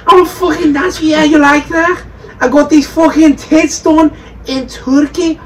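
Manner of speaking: mock Scouse woman at a house party; Scouse accent. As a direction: ham up a brash Scouse woman flirting. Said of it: "it's a good accent".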